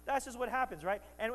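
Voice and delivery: ordinary delivery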